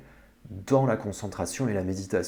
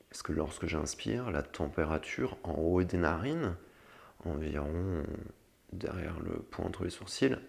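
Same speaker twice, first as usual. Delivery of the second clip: guided meditation; 4 semitones lower, 8 dB quieter than usual. Acted. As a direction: lead them gently into calm. (voix douce de méditation guidée)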